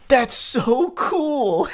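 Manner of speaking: slow, high, hollow game-character line; NPC dialogue. Retold or imitated. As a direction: read it like a canned video game NPC. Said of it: "N P C dialogue".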